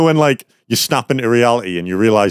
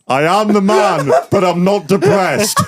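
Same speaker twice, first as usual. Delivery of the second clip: slow and higher, breaking into laughter; laughing reply; 9 semitones higher, slower than usual. Retold or imitated. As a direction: deliver the punchline while cracking up. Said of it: "do you know what I reply?".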